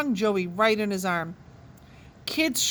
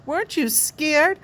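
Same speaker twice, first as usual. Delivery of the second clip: put-on fawning girl; 6 semitones higher than usual. A narrator's reading. Judy fawning.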